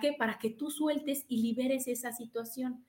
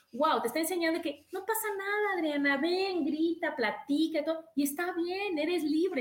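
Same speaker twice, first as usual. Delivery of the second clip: higher, lively pep talk to herself; a higher, excited inner cheer; cheering encouragement; 5 semitones higher than usual. Retold or imitated. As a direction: cheer her on to be free, pitched up. Inner voice encouraging her freely.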